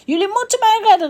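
high, loud, fast demand; angry shout. A narrator's reading. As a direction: princess furiously ordering a rescue. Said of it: "werd de prinses nog bozer".

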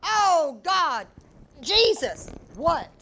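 loud startled exclamations; panicked exclaiming. Retold or imitated. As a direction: blurt out in shock. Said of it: "panicked exclamations".

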